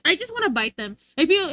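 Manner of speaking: ordinary talk, nothing marked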